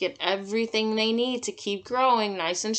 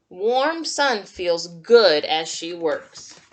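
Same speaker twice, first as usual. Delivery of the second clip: slow, clear picture-book reading; reading to children; 5 dB louder than usual. Acted. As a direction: read it like storytime for little kids.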